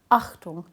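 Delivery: "Achtung" started hard from a closed glottis; glottal stop onset. Shown as a demonstration. Linguist demonstrating the stop sound, abrupt glottal "Achtung". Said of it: "I'll just do it for you".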